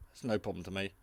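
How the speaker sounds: ordinary talk, nothing marked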